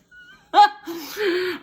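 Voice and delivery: high, loud laugh breaking into crying; laugh into tears. Real, not acted. Remembering the girl's kindness, laughing and crying. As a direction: laugh until it turns to crying. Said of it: "And I'd laugh like that".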